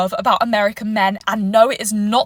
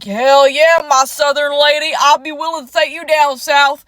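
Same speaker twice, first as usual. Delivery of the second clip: put-on Southern American accent; 4 dB louder than usual. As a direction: imitate confident Southern men sliding into comments. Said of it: "has a southern accent to me".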